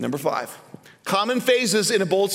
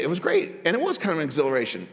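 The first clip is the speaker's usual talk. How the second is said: normal talking voice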